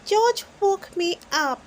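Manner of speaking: put-on high little-girl piggy voice, a bit cross; tattling little sister, high and loud; Peppa voice. A narrator's reading. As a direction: complain as a small girl whose brother woke her. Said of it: "says Peppa".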